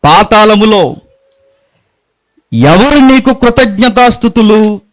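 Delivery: slow, high, drawn-out scripture reading; psalm recitation. Acted. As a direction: intone the psalm slowly like a lament.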